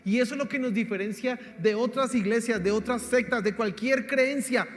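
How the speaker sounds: loud, high proclamation, slowing on the last lines; fiery preaching. Real, not acted. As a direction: declare it from the pulpit with full conviction. Preaching climax on God's power.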